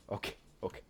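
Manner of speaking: breathless whisper under the breath; soft nervous whisper. Real, not acted. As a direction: whisper it to yourself, tense. Nervous landing mutter.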